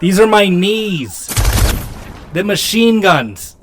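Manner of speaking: put-on slow, loud Boston accent with a laugh. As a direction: drawl it in Boston, cracking up. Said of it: "super thick Boston accents".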